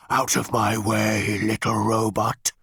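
slow, loud machine growl; growling tank. Acted. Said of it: "growled the tank".

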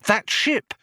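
high, eager rising question; sudden excitement. A narrator's reading. Ford suddenly interested in the dead police ship, excited and high. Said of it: "said Ford in sudden excitement".

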